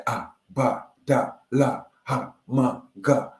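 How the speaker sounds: Ah, ba, da, la, ha, ma, ga sounded out as single syllables; ancestral tongue syllables. Shown as a demonstration. Speaker showing how his people sound, chanting syllables. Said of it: "hear how we sound".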